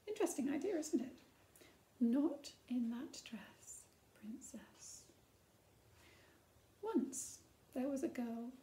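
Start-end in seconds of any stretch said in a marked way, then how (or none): 2.0-5.0 hushed, slow warning whisper; stern whisper. Acted. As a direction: breathe the queen's rule almost silently. Queen forbids princess, story read aloud.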